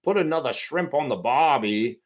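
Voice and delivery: mock Australian accent on a cliché line. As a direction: toss it off like a cheesy Aussie ad.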